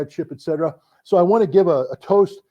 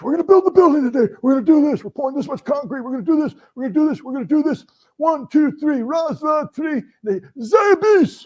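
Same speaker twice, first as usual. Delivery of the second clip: high, loud rallying speech to the troops; Russian colonel's morning pep speech; 11 semitones higher, 5 dB louder than usual. Retold or imitated. Colonel rallying his construction troops, loud and high. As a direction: fire up the work crew, shouting it high. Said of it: "he'd give them this speech".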